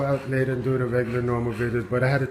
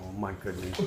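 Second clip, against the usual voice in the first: barely audible, much softer and lower; quiet, low disbelief; 7 semitones lower, 15 dB quieter, faster than usual. Real, not acted. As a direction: breathe it out, worn out. Exasperated at dog again.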